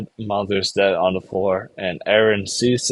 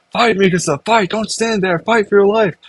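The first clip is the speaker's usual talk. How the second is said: urging yell; 9 semitones higher than usual. Acted. Boy being choked yells at the girl to fight, high and urgent. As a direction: spur her to fight back. Eren urges Mikasa to fight.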